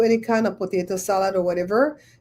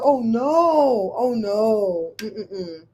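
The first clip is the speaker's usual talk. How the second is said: disgusted oh no; much slower than usual. Retold or imitated. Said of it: "I said".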